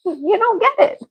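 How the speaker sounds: high, half-laughing you don't get it; high-pitched exasperated complaint. Retold or imitated. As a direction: plead to be understood, laughing. Retelling refusal, laughing exasperation.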